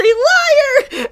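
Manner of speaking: put-on shrill little-girl shriek of "Liar!"; child's scream. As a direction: hurl "Liar!" high and loud like an outraged kid. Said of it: "just screaming".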